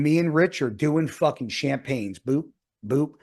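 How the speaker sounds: ordinary delivery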